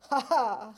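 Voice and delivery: loud mocking "ha ha"; scornful staged cackle; sneering laugh. A narrator's reading. Farmer eyeing his rival's trap, sneering laugh. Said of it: "ricana Monsieur Legrand".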